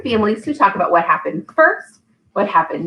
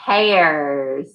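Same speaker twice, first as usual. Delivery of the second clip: slow, drawn-out storybook reading; patient read-aloud for a toddler; reading to a child; 2 semitones higher, slower than usual. Acted. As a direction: leave pauses for the child to fill in. Reads The Very Hungry Caterpillar.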